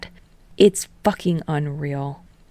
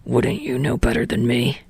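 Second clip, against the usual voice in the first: lower, dismissive scoff of a question; 4 semitones lower than usual. A narrator's reading. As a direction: brush her off with a scoff, voice dropped low. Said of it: "He scoffs".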